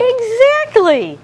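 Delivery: drawn-out high exactly; teacher's high praise; high slow praise. Shown as a demonstration. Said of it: "praising the class".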